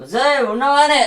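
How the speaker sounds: a high call across the house. Acted. Drunk husband calling his wife from the door, high call.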